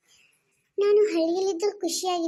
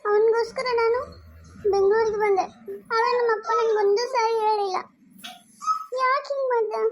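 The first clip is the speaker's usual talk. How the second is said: tearful crying; 5 semitones higher than usual. Acted. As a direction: cry it out, begging why. Daughter cries over her father.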